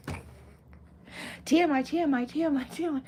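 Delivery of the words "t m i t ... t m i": higher and louder, chanting TMI; mock alarm. Real, not acted. Scolding herself after an overshare, loud chant. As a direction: shout it like an alarm going off.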